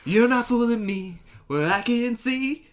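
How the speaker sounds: high loud slow mock calling; drunk yelling outside. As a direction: holler into the dark, hammy. Calling out in the night.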